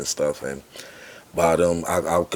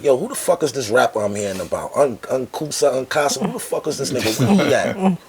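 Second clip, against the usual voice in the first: high, demanding boss voice; brash exec; 7 semitones higher than usual. Retold or imitated. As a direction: bust in and demand the rapper. Record boss bursts into room.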